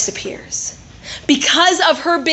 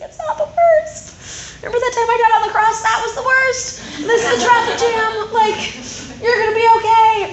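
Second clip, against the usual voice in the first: high, laughing, teasing reassurance; amused Jesus; 9 semitones higher than usual. Retold or imitated. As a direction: talk her down with a laughing grin. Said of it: "And he's like".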